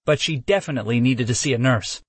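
usual speaking voice

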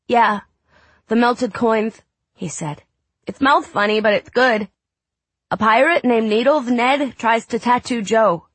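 put-on high small boy voice; a sick little boy; young boy voice. Child Drew voiced high.

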